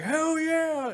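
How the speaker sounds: high excited whoop; hammed-up hell yeah; mock cheer. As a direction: cheer like the crew celebrating a hit. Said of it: "they're like".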